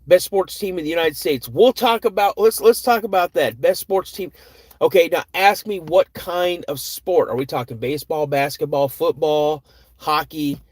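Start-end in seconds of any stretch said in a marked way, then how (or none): 0.1-7.3 excited. Real, not acted. Reading out a chat question, excited.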